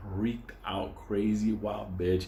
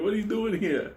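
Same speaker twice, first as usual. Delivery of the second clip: mock shocked wife; 9 semitones higher than usual. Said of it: "She's like".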